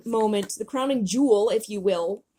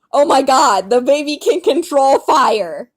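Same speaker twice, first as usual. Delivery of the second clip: loud, raised, drawn-out amazement; shocked shout; 6 semitones higher, 12 dB louder, slower than usual. Acted. Character discovers baby can control fire, shouting.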